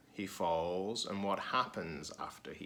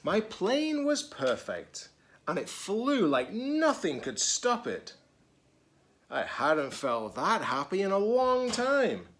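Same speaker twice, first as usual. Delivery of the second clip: lively, higher storybook reading voice; reading to children; 5 semitones higher, 6 dB louder than usual. A narrator's reading. Teacher reading a picture book to children, lively. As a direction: read it brightly to the kids.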